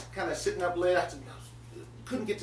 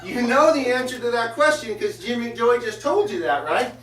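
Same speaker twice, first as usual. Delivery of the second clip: loud, higher exasperated rant; 6 semitones higher, 6 dB louder than usual. Real, not acted. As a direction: shout your disbelief at the dumb question. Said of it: "And I'm thinking".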